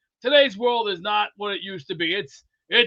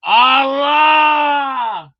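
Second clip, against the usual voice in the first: loud mock outburst, voice pushed away from his own; mock shout; 3 semitones higher, 7 dB louder, much slower than usual. Telling the hosts about his graduation hangover, a loud mock outburst. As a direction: yell it out like a hungover rant. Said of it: "and go".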